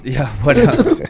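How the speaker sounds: laughing it off, loud and high. Real, not acted. Laughing at a friend's Hitler joke, loud laughter. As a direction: chuckle through the words.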